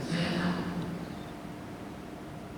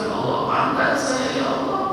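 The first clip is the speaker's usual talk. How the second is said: high pleading cry rising at the end; tearful plea; 10 semitones higher, 3 dB louder than usual. Retold or imitated. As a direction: cry out to God. Re-enacting tearful prayer. (rintihan doa memelas)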